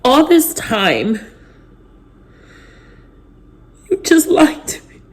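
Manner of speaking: high, broken voice through tears; words cracking as she cries; crying. Real, not acted. To her viewers, sobbing in disbelief. Breaking down over betrayal.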